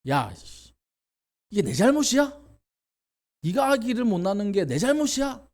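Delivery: high, loud exasperated outburst; angry retort. Retold or imitated. Jacob snapping back at Rachel, exasperated angry outburst. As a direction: snap back at a nagging wife.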